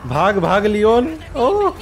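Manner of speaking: high, loud panicky cry; alarmed shout climbing to a yelp; panicked urging. Real, not acted. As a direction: yell at a game character to run, stretched out. Urging game character to flee.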